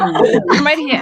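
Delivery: slow, loud laughing reply. Pretend. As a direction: laugh it out slowly, playfully loud. I'm gonna find you.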